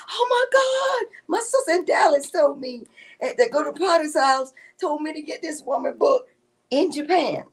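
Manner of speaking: high, loud retold shriek; screaming and hollering. Author retelling a reader finding her book in Japan, screaming and hollering. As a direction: imitate an astonished reader hollering. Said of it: "started screaming and hollering".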